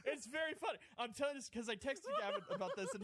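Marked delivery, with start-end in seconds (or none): 0.0-0.8 high voice, laughing through the words. Real, not acted. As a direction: admit it's funny while still laughing.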